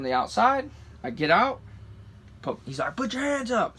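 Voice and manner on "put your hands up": higher-pitched mock police command; lightly voiced officer giving an order; cop's order. Cop ordering driver out of truck, mock command.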